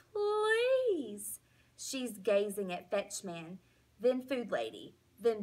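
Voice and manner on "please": much higher and louder drawn-out wail; wailing plea. Acted. Dog wailing at Hattie for food, loud and drawn out. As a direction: howl the last please. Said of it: "I whine some more".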